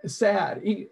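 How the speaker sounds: usual speaking voice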